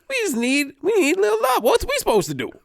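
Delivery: very high-pitched imitation of a child; little kid. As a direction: plead like an animated kid being interviewed. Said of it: "The kid's just like".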